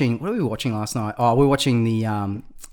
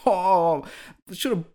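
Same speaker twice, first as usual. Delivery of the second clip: high mock regret; regretful client; 6 semitones higher than usual. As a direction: play the client who wishes.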